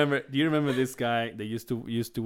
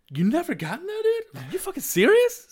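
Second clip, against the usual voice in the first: much higher, rising disbelief; high incredulous friend-voice quote; mock outrage; 6 dB louder than usual. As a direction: squeal of shocked disbelief at a friend. Buddy's mock outrage retold.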